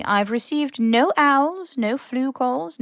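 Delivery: measured stern professor; firm headmistress. A narrator's reading. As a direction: lay it down with finality. McGonagall firm, slow, higher.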